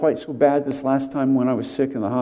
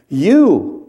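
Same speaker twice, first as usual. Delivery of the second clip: You punched higher and louder; emphatic you; 4 semitones higher, 7 dB louder than usual. Shown as a demonstration. Pastor telling the congregation to underline You, emphatic. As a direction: boldface the word You. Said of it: "Underline it, boldface it".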